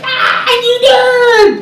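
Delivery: very high, loud, drawn-out squeal; excited squeal. Retold or imitated. Singer retelling her reaction to the man's joke, excited squeal. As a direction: re-enact a squealed outburst, stretched out high.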